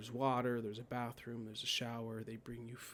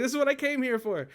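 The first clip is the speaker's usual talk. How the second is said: laughing elation; 12 semitones higher, 11 dB louder than usual. Retold or imitated. Ecstatic realisation, laughing.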